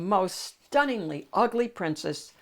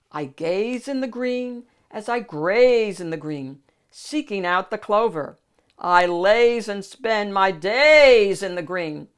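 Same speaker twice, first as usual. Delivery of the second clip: lightly voiced lazy donkey, a bit louder; a touch of a drowsy braying donkey; sleepy donkey; 2 semitones higher, 8 dB louder than usual. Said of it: "raised his sleepy eyes and brayed".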